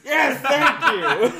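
loud, high, drawn-out thanks; relieved outburst. Real, not acted. Friend given the word at last, relieved shout. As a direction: burst out laughing with relief.

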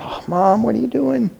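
a hint of a mortified teenage son; embarrassed son. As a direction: play John cringing at his mom. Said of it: "I can see John shaking his head going".

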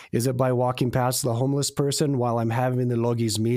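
ordinary talk, nothing marked

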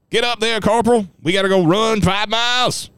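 sergeant's mock yell. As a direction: rouse the bunks in a high, put-on holler. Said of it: "I'm getting yelled at from my bunk".

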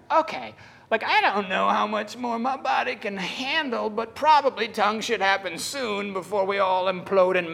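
high, strained-sounding mock overwhelm. Preacher retelling his plea under the heavy Spirit, overwhelmed. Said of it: "it got so heavy that I was like".

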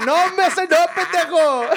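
high mock-outraged yell; exasperated scolding. Master builder correcting his clueless helper, exasperated. Maestro regaña al chalán confundido.

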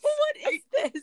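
high, slow, giggling what is this; laughing question. Real, not acted. Cohost laughing at a strange picture in the notes. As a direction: ask while bursting out laughing.

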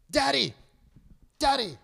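put-on high-pitched kid calling for Dad, no real volume; mock child's yell. Nine-year-old calling Daddy across the house, mock yell. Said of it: "He's yelling out "Daddy" across the house".

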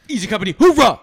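loud, high soldier's hoorah; rallying war cry. Acted. Co-host playing an Easy Company soldier, rallying shout. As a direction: shout it like a charging paratrooper.

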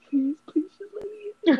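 hammed-up plea to the night clerk; mock begging. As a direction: beg like a helpless kid. Retold begging the hotel clerk.

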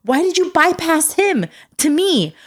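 high, incredulous mock protest; exasperated. Mother asking child why dad was skipped, mock exasperation. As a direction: demand it like a put-upon parent.

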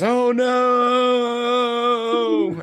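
a sudden high-pitched cry of pain; high squealing oh no; high yelp. Acted. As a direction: squeal it out like a hurt little creature. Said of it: "and he goes".

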